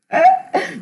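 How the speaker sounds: a short, high burst of laughter. Real, not acted. Reader laughing at her own Scorpio joke, high and bright. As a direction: let a high laugh pop out.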